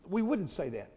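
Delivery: ordinary delivery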